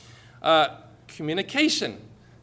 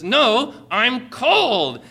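louder, higher exasperated wife; raised-voice comic consternation; mock consternation; 7 semitones higher, 6 dB louder, much slower than usual. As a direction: imitate a woman insisting, voice raised in frustration. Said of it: "with greater consternation in her voice".

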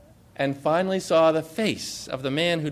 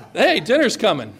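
bored dismissive listener; 4 semitones higher than usual. Retold or imitated. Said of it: "Or is it like".

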